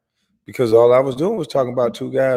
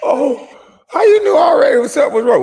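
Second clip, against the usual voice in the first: surprised; 5 dB louder than usual. Retold or imitated. Acting shocked wife reaction.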